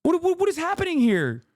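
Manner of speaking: what is even happening, voice rising; outraged high-pitched disbelief; incredulous. Real, not acted. Exasperated disbelief at converts.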